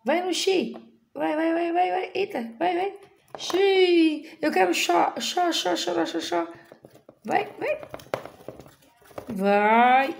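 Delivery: high, bouncy urging; teacher cheering the marble game, high-pitched; playful cheering. Pretend. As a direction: coax the marble along, squeaky with fun.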